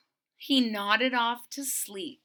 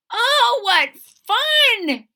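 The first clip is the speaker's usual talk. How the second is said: high, loud and slow, a joyful bear squeal; delighted; 13 semitones higher, 11 dB louder, much slower than usual. Acted. Corduroy sliding on soap flakes, squealing with delight. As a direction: stretch out the delight, much higher and louder. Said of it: "he said with a smile".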